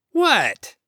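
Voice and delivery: high, loud defensive question; irritated challenge. Acted. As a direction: snap back at the teasing. Adam bristles at her mockery.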